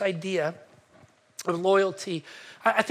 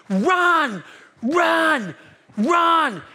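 retold yell; 7 semitones higher, 5 dB louder, much slower than usual. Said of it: "he's yelling something".

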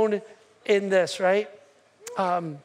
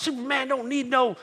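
Muhammad Ali; 5 semitones higher, 3 dB louder than usual. Retold or imitated. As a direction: imitate an arrogant champion, stretching out the boast. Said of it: "'cause that's how he talks".

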